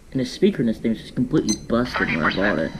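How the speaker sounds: ordinary delivery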